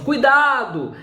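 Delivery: sharp high warning cry of Cuidado; exclamation. Shown as a demonstration. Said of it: "quando eu falo assim".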